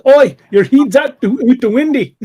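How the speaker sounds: high, loud mock Scottish shout; Scottish accent. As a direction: yell it in a put-on Scottish brogue. Reads chat message in mock Scots.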